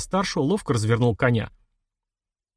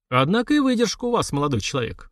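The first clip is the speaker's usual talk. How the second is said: mildly taken aback, starting high and falling away; understated bewildered admiration; bewildered exhale; 7 semitones higher than usual. A narrator's reading. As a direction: breathe out in puzzled respect. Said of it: "растерянно выдохнул князь".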